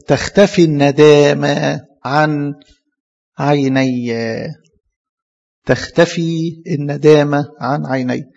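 very slow, deliberate verse reading; drawn-out reading of the verse; slow scripture reading. Acted. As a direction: lay each word down slowly. Reads the verse aloud slowly.

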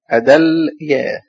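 أدليا slow, stopping on the vowelless L; spelled out by syllables. Shown as a demonstration. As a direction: sound out أدليا as a-dal, yaa. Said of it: "اللام عليها سكون".

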